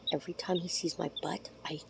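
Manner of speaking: whispered confession; hushed, conspiratorial aside. Real, not acted. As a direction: drop to a whisper as if sharing a secret. Confiding about chicken laughing at butt.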